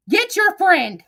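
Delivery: ordinary talk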